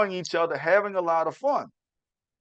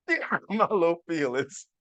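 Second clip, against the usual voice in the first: mock wounded. Dad repeating to listeners that his daughter hurt him, mock-sulking. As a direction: play the wounded dad, tongue in cheek.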